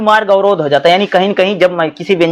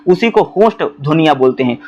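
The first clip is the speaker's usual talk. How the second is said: normal talking voice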